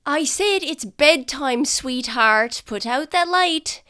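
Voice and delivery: high, loud parental call; stern bedtime order. Acted. Parent calling lights out to the child, stern and loud.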